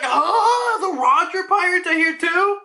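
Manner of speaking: surprised laughing gasp. Retold or imitated.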